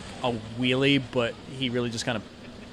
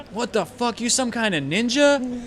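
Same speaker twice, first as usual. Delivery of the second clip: high-pitched incredulous question; astonished; 8 semitones higher than usual. Acted. Thug who lost the fight questions player, astonished. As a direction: thug stunned by his opponent.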